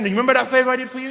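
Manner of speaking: fast, nagging reminder; smug reminder. Retold or imitated. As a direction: rattle it off, rubbing it in. Reminding again.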